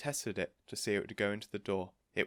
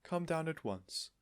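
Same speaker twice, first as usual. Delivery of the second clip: higher, slow, keen; eager invitation; 8 semitones higher, slower than usual. A narrator's reading. Mrs Silver's voice.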